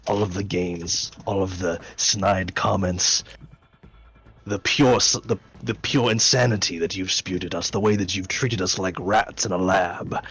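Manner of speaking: loom over her and accuse; menacing. Acted. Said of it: "subconsciously being menacing".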